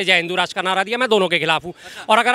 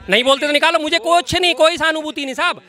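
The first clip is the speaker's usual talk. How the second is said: real heated, higher-pitched protest; heated insistence; 6 semitones higher than usual. Real, not acted. Debater disowning the MP to the anchor, heated insistence.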